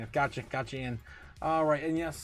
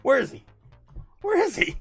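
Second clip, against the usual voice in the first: mock excited; 10 dB louder than usual. Joking search for robot.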